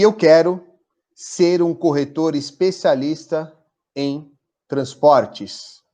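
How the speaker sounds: slow, deliberate affirmation read; slow dictation. Shown as a demonstration. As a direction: dictate each phrase slowly with long pauses. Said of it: "eu vou até falar devagar pra vocês anotarem". (ditado lento e pausado da afirmação)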